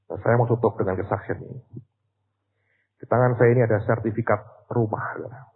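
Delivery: ordinary delivery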